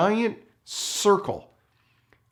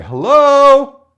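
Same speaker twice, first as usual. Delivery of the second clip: much higher and louder, called out long; 12 semitones higher, 15 dB louder than usual. Retold or imitated. Scout calling to whoever is inside, loud call. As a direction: shout it into the house.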